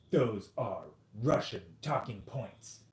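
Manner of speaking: slow, emphatic put-on accusation; mock accusation. Podcaster mimicking a debate opponent who dismisses him, mock accusation.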